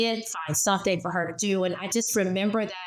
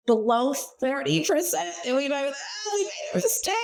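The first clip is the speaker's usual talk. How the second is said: high, half-laughing whimper of defeat; mock wail; 7 semitones higher, faster than usual. Host recalling the team's feared mistake, mock crying. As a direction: ham up a teary oops. Admitting failure, mock lament.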